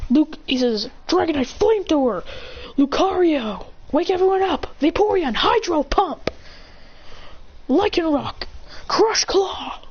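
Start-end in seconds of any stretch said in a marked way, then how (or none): 1.1-9.8 high-pitched called-out move; attack command. Acted. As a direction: call the attack across the field. Trainer shouts attack commands.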